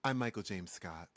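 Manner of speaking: low, hushed radio host voice; mock radio voice, pitched well down; Delilah. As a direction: join in with a low late-night radio sign-off. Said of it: "I have to do my Delilah radio voice".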